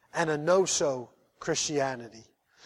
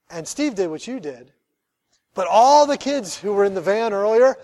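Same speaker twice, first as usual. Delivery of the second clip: ordinary delivery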